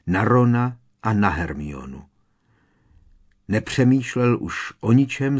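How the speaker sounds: plain reading voice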